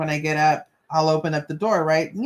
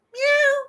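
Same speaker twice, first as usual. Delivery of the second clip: a tiny, much higher imitated meow; mock cat meow. Imitating the cat's reply.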